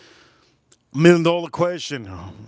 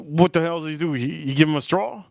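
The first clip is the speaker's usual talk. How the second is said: normal talking voice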